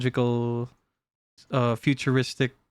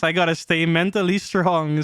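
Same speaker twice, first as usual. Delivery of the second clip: usual speaking voice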